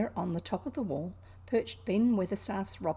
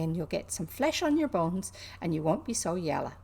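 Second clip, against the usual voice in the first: lightly voiced Yorkshire maid; a hint of broad Yorkshire; mild Yorkshire lilt; Yorkshire accent. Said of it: "apologies for the accent".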